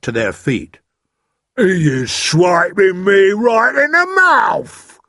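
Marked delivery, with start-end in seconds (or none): 1.6-5.0 high, loud, slow giant's holler; outraged yell. A narrator's reading. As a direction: yell like a giant just hit. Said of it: "yelled the meat dripper".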